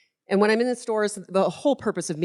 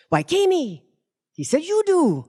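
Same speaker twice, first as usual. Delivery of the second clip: higher, louder mock French accent of the CEO; French accent impression; 11 semitones higher, 4 dB louder than usual. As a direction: put on a playful French accent for the boss. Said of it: "He's French".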